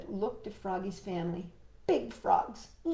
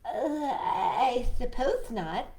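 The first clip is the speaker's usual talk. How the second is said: slow, hesitant, lightly voiced rabbit; a polite rabbit giving in unwillingly; reluctant Rabbit; 3 semitones higher, 3 dB louder, much slower than usual. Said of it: "said Rabbit".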